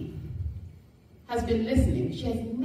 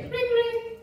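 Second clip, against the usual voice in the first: mock phone ring. Imitating a phone ringing.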